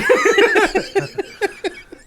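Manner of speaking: squealing laugh; cracking up high; high laughing fit. Real, not acted. Laughing at the bit.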